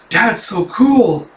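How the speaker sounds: high, stretched-out fangirl praise; slow, high gush of admiration; admiring child. Retold or imitated. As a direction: imitate a thrilled kid. Child admiring her father.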